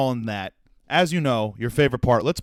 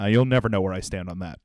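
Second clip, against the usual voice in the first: ordinary talk, nothing marked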